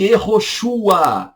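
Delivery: Yehoshua drawn out slowly, ye-ho-shu-a; slow Hebrew name. Shown as a demonstration. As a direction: stretch Yehoshua for the class. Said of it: "português".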